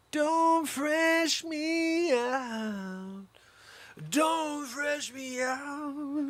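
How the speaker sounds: high, very slow, stretched out; sing-song each word long and high; a silly high drawn-out scold; drawn-out catchphrase. Shown as a demonstration. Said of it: "repeats his catchphrase stretched".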